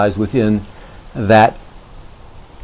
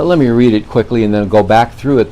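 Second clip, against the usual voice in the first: ordinary talk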